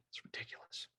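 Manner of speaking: breathy throwaway whisper under the breath; whispered exasperation; whispered aside. Real, not acted. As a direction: drop to a whisper in disbelief. Exasperated aside about easy tracking.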